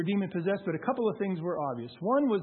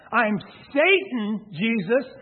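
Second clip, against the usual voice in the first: loud, hammered-out declaration of hate; retold menacing shout, drawn out on the repeat; imitated shouting; 3 semitones higher, 8 dB louder, slower than usual. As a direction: shout it in his face, punching the key words. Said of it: "he's shouting this in my face".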